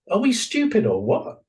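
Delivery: high, incredulous rhetorical question; exasperated. Real, not acted. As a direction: throw up your hands at human folly.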